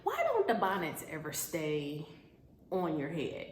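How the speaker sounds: usual speaking voice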